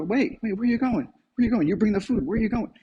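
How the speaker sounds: high, pleading voice of a let-down dog; dog calling after the departing helper, mock disappointed. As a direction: beg the food guy to stay, lightly. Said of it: "begins to become disappointed".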